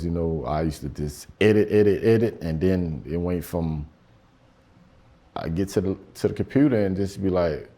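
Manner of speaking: ordinary delivery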